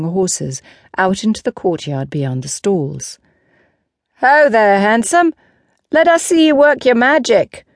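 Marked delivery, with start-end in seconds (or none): 4.2-7.6 higher, louder teasing call from the crowd; raised, high-pitched heckle; woman calling out. Acted. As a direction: call out playfully over a crowd. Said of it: "a woman called to Lancelot".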